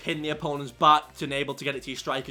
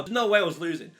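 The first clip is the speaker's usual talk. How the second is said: ordinary talk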